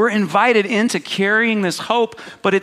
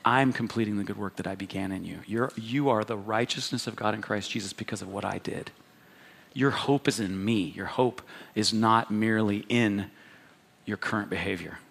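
soft, much lower and quieter reassurance; tender voice of the Lord; 9 semitones lower, 10 dB quieter than usual. Retold or imitated. The Lord reassuring a perfectionist, soft and much lower. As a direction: gently correct a worried child. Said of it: "And the Lord's like".

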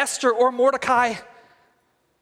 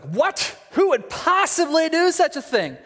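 higher, hammed-up royal indignation; mock outrage, pitched up; indignant king; 6 semitones higher than usual. King asks the queen who threatens her, indignant.

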